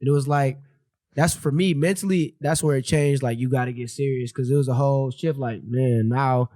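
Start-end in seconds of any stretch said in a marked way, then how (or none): none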